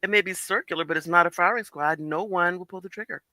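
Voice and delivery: ordinary talk, nothing marked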